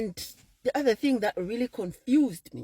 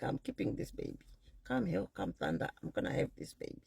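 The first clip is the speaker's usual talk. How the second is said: quiet resolve; 8 semitones lower, 8 dB quieter than usual. Retold or imitated. Inner resolve, half voiced.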